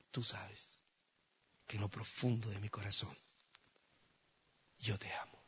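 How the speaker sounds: soft, half-whispered words; hushed confession. Acted. Preacher confessing love to the Lord, hushed. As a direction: drop to a near whisper.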